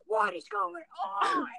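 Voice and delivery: high, drawn-out imitation of a dad; mock a bewildered dad's outburst; alarmed dad. Dad reacting to his screaming daughter, mock alarm. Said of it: "it's me screaming. Like, literally screaming. And my dad's like".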